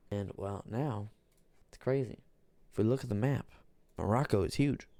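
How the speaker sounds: usual speaking voice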